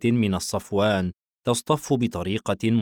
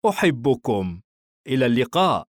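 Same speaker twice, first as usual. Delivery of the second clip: lightly voiced father, only slightly raised pitch; father's farewell; 3 semitones higher, 3 dB louder than usual. Father leaving the palace, restrained loving farewell. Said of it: "الأب".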